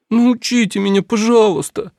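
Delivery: put-on high, pleading girl voice; pleading princess. A narrator's reading. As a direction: beg like an eager young girl. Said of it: "умоляла принцесса".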